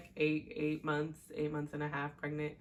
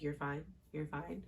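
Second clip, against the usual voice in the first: unspoken self-talk; 9 dB quieter than usual. Retold or imitated. Woman talking herself down from panic, quietly. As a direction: quietly reassure yourself, as if only thinking it. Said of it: "I didn't even say it".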